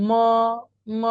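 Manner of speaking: long held ম; Pinyin m as ম. Shown as a demonstration. Teacher models m for the class, drawn-out ম. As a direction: draw ম out as a model syllable. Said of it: "এম এর উচ্চারণ মর মতো".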